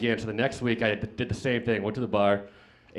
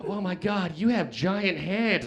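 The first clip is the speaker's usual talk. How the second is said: higher, drawn-out swooping marvel; awestruck girl; 5 semitones higher, slower than usual. Retold or imitated. Girl amazed at the size of his hands, awestruck. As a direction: stretch it out in amazed wonder.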